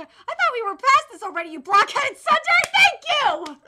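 loud, high, rising rebuke; exasperated scolding. Acted. Scolding the blockhead who missed the point, exasperated shouting. Acted scolding of the other character.

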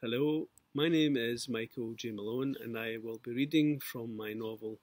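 plain reading voice

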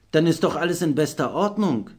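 cheerful loud exclamation; exclaiming. A narrator's reading. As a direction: call it out brightly, voice jumping up. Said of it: "rief Lukas aus".

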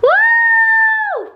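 loud, high cheer; excited whoop. Real, not acted. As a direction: whoop with joy. Room makeover, first piece done.

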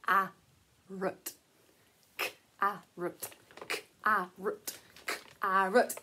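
K-a-rut split into slow low sounds; sounding out carrot. Shown as a demonstration. Teacher breaking the word into sounds for kids.

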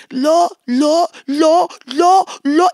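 loud, strained broken repeats; the intruder failing to say Lord, strained; struggling demon. Retold or imitated. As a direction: choke on the word like a demon.

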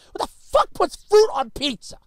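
usual speaking voice

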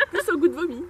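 laughing. Real, not acted. Laughing at the vomit flavour.

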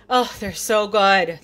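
usual speaking voice

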